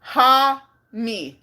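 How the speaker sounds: "Ha-mi" drawn out as HAH, then MEE; "Ha-mi" with an open ah and a gap before mee; syllable-by-syllable pronunciation. Shown as a demonstration. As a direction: sound out "Ha-mi" slowly in two separate syllables. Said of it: "Let's see how to pronounce this".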